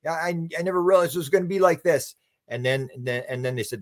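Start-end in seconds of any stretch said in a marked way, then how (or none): 0.1-2.1 high-pitched protest at normal volume; mock yell of aggrieved disbelief; imitated yelling. Man complaining to the beings, in a mild mock yell. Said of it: "he's yelling at these beings".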